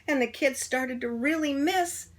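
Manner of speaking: plain reading voice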